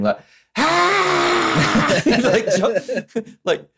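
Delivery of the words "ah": high hammed-up scream; mock scream. Podcaster mimicking Forrest Whitaker's scream through flames, high yell. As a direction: squeal it like jumping through flames. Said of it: "he's, like, screaming".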